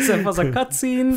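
high mock German accent; old German guy.

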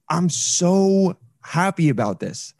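exasperated mock joy, drawn out; sarcastic. Real, not acted. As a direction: stretch out the false delight. Sarcasm over broken Zoom audio.

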